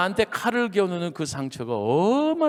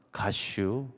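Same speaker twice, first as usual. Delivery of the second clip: low, softer terse Chungcheong word; Chungcheong dialect; 7 semitones lower, 6 dB quieter, much slower than usual. Shown as a demonstration. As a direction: economical Chungcheong deadpan demo. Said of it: "충청도는 뭐라고 하냐면". (짧고 낮은 충청도 사투리로)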